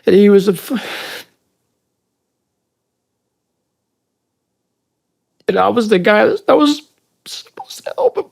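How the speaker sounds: real breakdown, voice high and strained; sobbing confession, words breaking off into squeaks; crying. Real, not acted. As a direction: choke it out through tears, halting. Breaks down confessing his guilt.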